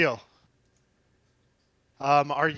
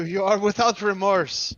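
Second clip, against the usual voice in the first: hammed-up German accent, slow and a bit higher; bad German accent; 5 semitones higher, 3 dB louder than usual. Trapped bandit yells back at the party in a bad German accent. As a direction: bark it slowly in a cheesy German accent. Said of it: "He yells back in a bad German accent".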